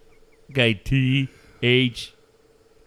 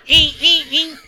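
higher, louder "eek eek eek" horn beeps; mock car horn; 10 semitones higher, 6 dB louder than usual. Driver honking in traffic, imitated horn beeps. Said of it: "punching the steering wheel and your horn".

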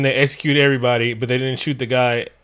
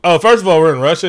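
exasperated outburst; 4 semitones higher, 6 dB louder, faster than usual. Real, not acted. Exasperated rant about movie.

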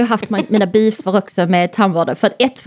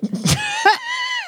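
laugh; 5 dB quieter than usual. Real, not acted. Laughing at own punchline.

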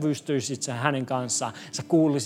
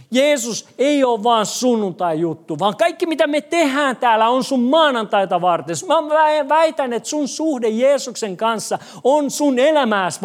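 raised, urgent sermon voice, slowing to stress each point; fervent preaching; 5 semitones higher, 3 dB louder than usual. Acted. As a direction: preach it out to the congregation. Pastor preaching to congregation. (kiihkeä saarnaava ääni)

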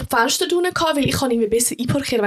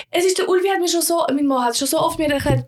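ordinary delivery

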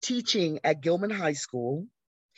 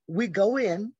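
ordinary talk, nothing marked

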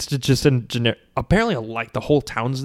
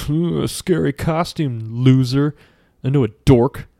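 louder, taunting bully impression; mocking bully; 2 semitones higher, 5 dB louder than usual. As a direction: sneer it like a playground bully. Bully mocking the troll, acted.

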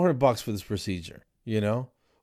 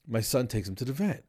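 usual speaking voice

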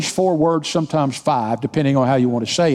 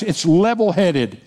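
normal talking voice

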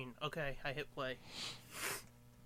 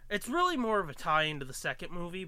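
ordinary delivery

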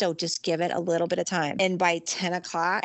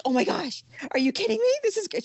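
much higher, squealing with delight; high-pitched overjoyed gasp; thrilled squeal; 13 semitones higher, faster than usual. Retold or imitated. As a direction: gush like a kid who got the best news. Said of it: "I'm so excited".

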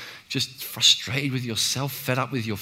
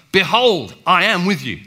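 loud, higher ringing declaration; emphatic proclamation; 6 semitones higher, 10 dB louder than usual. Retold or imitated. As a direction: proclaim it with force. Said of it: "emphatic terms".

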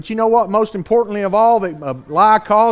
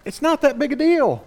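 higher-pitched brush-off of a doubter; imitated excuse, pitched up; dismissive objection; 7 semitones higher than usual. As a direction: play an objector shrugging it off. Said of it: "You say, "Yeah, but".

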